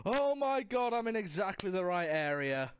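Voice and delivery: gasping, thrilled disbelief; high excited rush. Real, not acted. Reaches safe zone in game.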